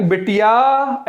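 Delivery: slow drawn-out call, बिटिया with the final आ stretched. Shown as a demonstration. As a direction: call बिटिया from inside the house, stretching the end. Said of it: "ऐसे बुलाएंगे".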